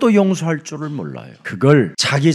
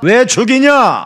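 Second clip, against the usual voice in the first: slow, raised reproach; stern rebuke; 6 semitones higher, 4 dB louder, slower than usual. Acted. Preacher voicing God reproaching the churches, stern rebuke. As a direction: draw out the rebuke on a higher pitch. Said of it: "그걸 책망하는 겁니다".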